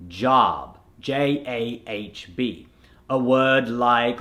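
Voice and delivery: job with an open "ah", J-A-H-B; American accent. Shown as a demonstration. Coach shows learners the converted job, American accent. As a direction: say "job" the American way, vowel opened to "ah". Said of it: "The American accent is gonna make it".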